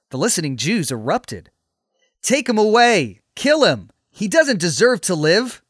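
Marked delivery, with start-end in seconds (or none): 2.2-5.6 loud, high cries of an outraged mob; acted screaming. Crowd erupting at Paul's story, acted angry screaming. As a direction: shout it out, demanding his death. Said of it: "they screamed".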